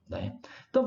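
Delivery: usual speaking voice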